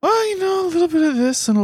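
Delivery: playful, very high squeaky voice; high-pitched squeal. Pretend. Co-host teasing after complaint about squeals, high-pitched squeaky answer. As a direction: squeak it out, easing down toward the end.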